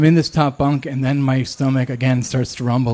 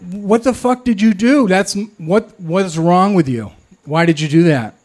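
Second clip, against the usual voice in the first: angry; 5 semitones higher than usual. Retold or imitated. Angry cellmate confronts narrator.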